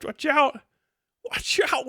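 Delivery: hammed-up "watch out" taunt; mock warning. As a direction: pretend to be spooky, tongue in cheek. Mock warning to forum users.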